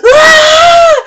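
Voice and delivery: piercing high scream, full force; cathartic scream. Retold or imitated. Scream at herself in the mirror, piercing. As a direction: let out a raw scream of release. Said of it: "I got so mad I started screaming it at myself".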